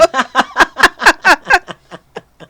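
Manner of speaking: high staccato peals of laughter; cackling laugh. Real, not acted. Storyteller tickled by her own dad joke, cackling. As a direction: keep cackling loudly until it dies away.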